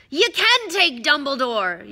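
loud, high outraged yell; angry gruff shout; Hagrid, yelling. Acted. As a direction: bellow in protest, drawing the words out. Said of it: "yelled Hagrid".

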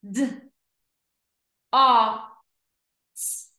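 D, o, s as separate letter sounds; long gaps between D, o and s; sounded out slowly. Shown as a demonstration. Teacher pointing to each dot, D-o-s sounded out. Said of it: "read each word slow".

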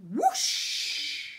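breathy whooshing sound effect. Acted. Reading to kids, making the launch noise. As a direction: blow it out like a rocket launch.

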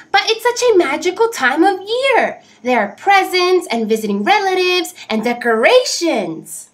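high, bright eager little bird; cheerful bird voice. Acted. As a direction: sell the magic of the season. Oxpecker praises the season.

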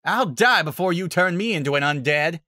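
loud defiant shout; shouting back. Acted. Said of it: "Applejack shouted back".

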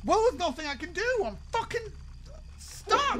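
high, strained desperate cry; frustrated panic; panicked. Real, not acted. Stuck while monster comes.

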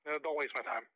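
ordinary talk, nothing marked

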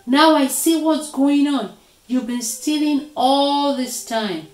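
indignant, high and loud scolding; furious accusation. A narrator's reading. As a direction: let the anger pour out. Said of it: "he was furious".

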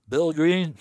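calls the name out loud and high; retold loud call of a name. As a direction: barks the name across the woods.

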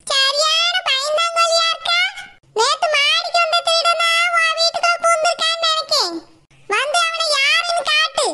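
fish-seller scolding the timid Saroja, high and slow; bossy fish-seller woman. Acted. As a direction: boast like a brash market woman, slowly.

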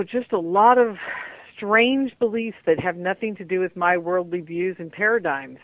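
normal talking voice

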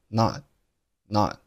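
very slow "not", mouth set for t but no release; "not" ending in a held, silent t; unreleased t in not. Shown as a demonstration. Teacher slowly showing the swallowed t in "not". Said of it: "读成了not，not".

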